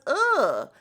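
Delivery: loud, high groan of disgust; disgusted ugh. Real, not acted. Host recoiling at the thought of Ralph, disgusted. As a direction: groan in revulsion.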